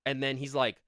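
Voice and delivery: ordinary delivery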